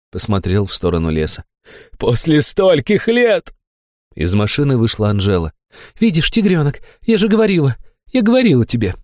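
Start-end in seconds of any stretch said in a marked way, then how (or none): 2.0-3.5 high, very slow exclamation; drawn-out rapturous cry; blissful elation. A narrator's reading. Fat man facing the forest, elated. Rises, rejoices toward the forest. 6.0-8.9 high character voice; Anzhela's voice. A narrator's reading. Anzhela tells the tigerling she told him so, high-voiced.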